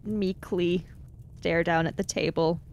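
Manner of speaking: ordinary talk, nothing marked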